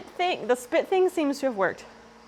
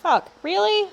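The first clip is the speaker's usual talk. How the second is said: sharp high frustrated swear; exasperated outburst; 9 semitones higher, 5 dB louder than usual. Real, not acted. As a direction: blurt it, fed up. Toothbrush dropped into spiders.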